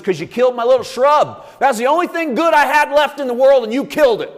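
high, loud, wailing complaint, voice cracking up; Jonah's angry outcry. Acted. Jonah shouting at God over his dead shrub, aggrieved wail.

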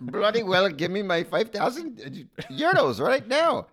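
high, loud mock accent, demanding the cash; a made-up accent, half British, half Icelandic; mishmash foreign accent. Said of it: "Almost was Icelandic for a second".

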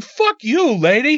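high-pitched, exasperated retort; indignant outburst, acted out; angry retort. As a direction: snap back at a stranger, retold.

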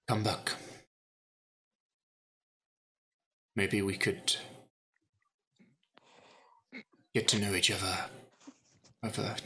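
hushed, pleading softly; soft quiet plea. Acted. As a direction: drop to a near whisper. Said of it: "he would say".

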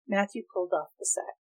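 plain reading voice

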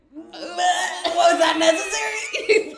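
loud, drawn-out high cry; exaggerated scandalized shout; mock outrage. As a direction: stretch it out in a shouted, playful protest. Mock outrage at actor licking lip.

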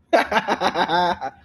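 loud, high burst of laughter; big laugh. Real, not acted. Reacting to co-host's silly self-promo, laughing loudly.

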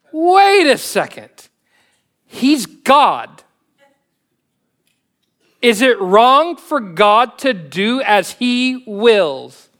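forceful challenge. Real, not acted. Preacher confronts listeners who call God wrong, loud and slow. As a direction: stop them short and press the question.

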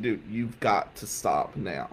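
low, slow, quiet warning; firm warning. Retold or imitated. Student pushing back a mentor's advance, firm warning. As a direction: draw the line slowly and quietly.

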